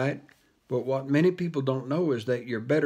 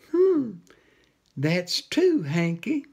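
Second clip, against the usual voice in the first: high whiny cat, smug and teasing; a smug cat purring his taunt; 5 semitones higher, slower than usual. Acted. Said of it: "said the cat".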